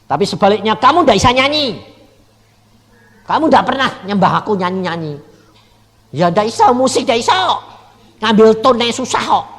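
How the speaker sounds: loud, fast protest; heated complaint. Retold or imitated. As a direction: argue your case to God, worked up. Retells his own complaint to God. (protes keras dan cepat)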